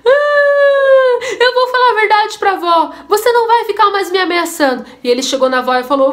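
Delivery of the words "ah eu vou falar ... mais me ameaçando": high, loud sobbing wail; child bursting into tears against his bullying sister; crying child. Acted. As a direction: cry it out like a cornered little boy. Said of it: "Aí ele começou a chorar".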